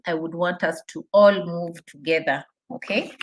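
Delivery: normal talking voice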